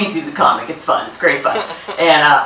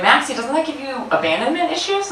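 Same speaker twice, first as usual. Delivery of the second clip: higher, softer, worried friend's voice; concerned friend; 8 semitones higher, 7 dB quieter than usual. Retold or imitated. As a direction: lift the pitch into a soft, worried friend.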